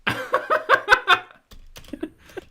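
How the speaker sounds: high, loud cackle; loud laughing burst. Real, not acted.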